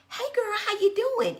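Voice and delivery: put-on high, sing-out girlfriend hello; gushing greeting. Shown as a demonstration. Friend greeting a girlfriend in passing, gushing and high.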